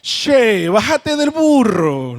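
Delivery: drawn-out Argentine "che", slow and loud; Argentine accent. Retold or imitated. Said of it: "hubiese sido argentino o uruguayo".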